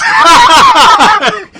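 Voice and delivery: a loud, high laugh bursting out; laughing hard at his own bit. Real, not acted. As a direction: crack up loudly at your own joke.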